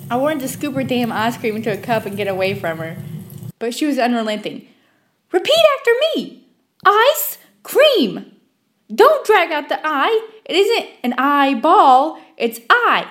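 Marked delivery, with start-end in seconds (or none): 5.3-13.1 much higher and louder, scolding the clerk's lazy tongue; shrill lecturing woman. Retold or imitated. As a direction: drill the word into her like a nagging tutor. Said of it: "with a shrill voice".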